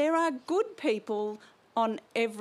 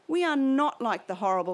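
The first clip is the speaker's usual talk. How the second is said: ordinary delivery, nothing marked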